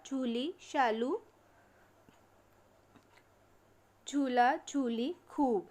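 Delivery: put-on each word said slowly and clearly for children to repeat; slow drilled word list. Shown as a demonstration. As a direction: read the list out like a teacher drilling a class. Teacher drilling word list aloud. (धीरे-धीरे साफ़ बोलकर शब्द अभ्यास)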